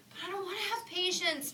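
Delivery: high whiny protest; protesting child. Acted. Little bunny protesting to her mother, high whine. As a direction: whine it like a stubborn little kid. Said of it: "Betty Bunny protested".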